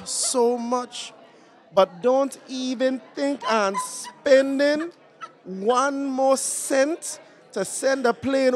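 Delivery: put-on high, strong Caribbean island accent, scolding lovingly; grandmother's Caribbean accent. Grandmother scolding [name] on the phone in Caribbean accent. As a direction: play his island grandma fussing at her grandson. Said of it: "That's my grandmother two days ago on the phone".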